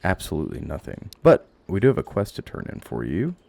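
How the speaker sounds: ordinary talk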